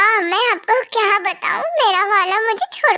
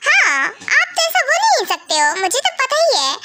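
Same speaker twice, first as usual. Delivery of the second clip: sarcastic wife mocking her husband's denial; sarcastic echo; 4 semitones higher than usual. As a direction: throw his words back with a laugh.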